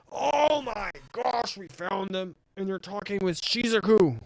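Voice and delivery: drawn-out, high, loud oh-my-gosh; excited squeal. Real, not acted. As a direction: gush loudly with thrilled discovery. Player thrilled to find characters.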